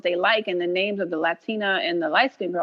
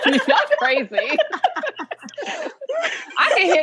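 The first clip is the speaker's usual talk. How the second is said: laughing through the words, a bit louder; 12 semitones higher, 3 dB louder than usual. Real, not acted. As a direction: crack up while calling them crazy. Laughing at hosts' reaction.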